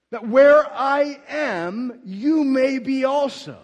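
loud, slow, high proclamation; proclaimed verse. Acted. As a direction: ring the promise out to the congregation. Repeats verse at climax.

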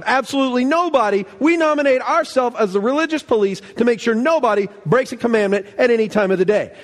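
mock the religious police, puffed up and pleased with themselves; hammed-up pious lawmaker bragging; smug Pharisee. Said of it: "they're going".

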